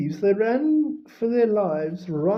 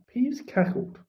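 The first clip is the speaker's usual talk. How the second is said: ordinary delivery, nothing marked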